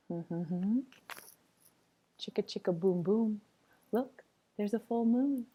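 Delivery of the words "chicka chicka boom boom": usual speaking voice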